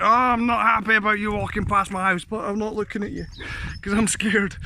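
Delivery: lightly voiced nervous dog. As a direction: voice the scared dog, a touch whiny. Voicing confused nervous dog.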